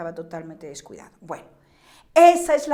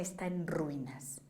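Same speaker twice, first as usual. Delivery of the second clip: breathy dramatic whisper; 6 semitones lower, 13 dB quieter than usual. Acted. Preacher to congregation, whispering the ruin. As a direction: drop to a hushed whisper for the ruins. The flesh-house left in ruins.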